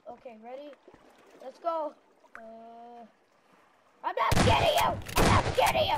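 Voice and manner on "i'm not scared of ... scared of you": very high, full-volume battle yell; defiant shout. Real, not acted. Player tells enemies he isn't scared, defiant shout. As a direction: scream bravado at the enemy.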